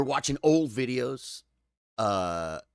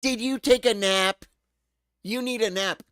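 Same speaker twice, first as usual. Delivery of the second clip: put-on high, loud little-kid voice; mock child's scolding, much higher and louder; imitating a little kid; 11 semitones higher, 8 dB louder than usual. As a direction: pipe it up like a bossy toddler. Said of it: "the kid's like".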